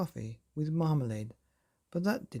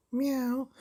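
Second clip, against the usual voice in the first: high mock meow; cat meow; 10 semitones higher than usual. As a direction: meow like a silly chicken-cat. Chicken voiced meowing.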